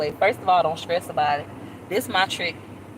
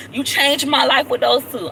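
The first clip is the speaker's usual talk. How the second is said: "You changed my life with those two" higher and louder, stress on "my"; excited thanks; 6 semitones higher, 5 dB louder than usual. Real, not acted. Vlogger repeating thanks to Heather, higher excited emphasis. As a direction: press the gratitude home.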